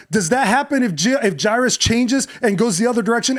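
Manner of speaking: ordinary delivery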